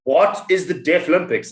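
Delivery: normal talking voice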